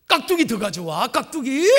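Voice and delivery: rising mock-angry demand; demanding customer. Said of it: "막 그러면서 화를 내더라고요".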